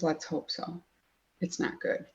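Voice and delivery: lightly imitating a receptionist breaking bad news; grave, hushed.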